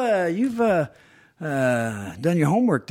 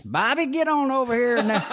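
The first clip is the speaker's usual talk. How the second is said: very high Southern coach voice; imitated coach, high Southern twang; high-pitched Southerner; 11 semitones higher than usual. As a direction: squeal it like a Southern baseball coach. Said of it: "one of those high-pitched Southerners".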